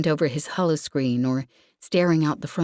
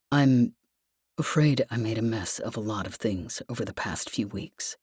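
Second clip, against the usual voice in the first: lightly lower, apologetic male voice; Lev's voice; 6 semitones lower, 4 dB quieter than usual. Acted. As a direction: voice him low and regretful, lightly done. Narrator voices Lev, male figure.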